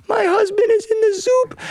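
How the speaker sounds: very high comic wail; hammed-up tearful lament; mock crying. Wife telling the cat about her husband, hammed-up high wail. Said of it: "Martina, why are you crying?".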